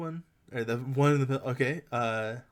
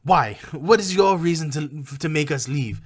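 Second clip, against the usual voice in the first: raised, challenging goblin voice; louder put-on goblin protest; defensive goblin; 5 semitones higher, 12 dB louder than usual. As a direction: push back as an indignant goblin. Goblin argues with the player.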